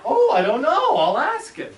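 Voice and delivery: high, loud imitation of mother; mother on phone. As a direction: answer the caller in a high mother voice. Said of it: "And then she said".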